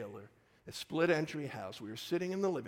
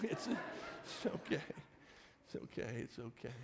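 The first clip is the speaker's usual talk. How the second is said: half-whispered self-reassurance; 2 semitones lower, 7 dB quieter than usual. Retold or imitated. Dad half saying it to himself, self-soothing whisper. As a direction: imitate reassuring yourself under your breath. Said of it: "I was half saying that to myself".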